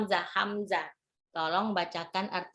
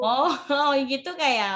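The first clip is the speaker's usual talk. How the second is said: laughing; 5 semitones higher, 4 dB louder than usual. Real, not acted. Teacher laughs at lisp remark.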